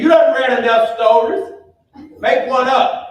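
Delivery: a loud, high grandma order; insistent grandmother. Retold or imitated. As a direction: push the boy to invent a story. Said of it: "She said".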